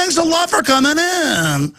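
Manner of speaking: very high, put-on singsong; exaggerated high-pitched mimicry; mock trendy voice. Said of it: "in the trendy voice".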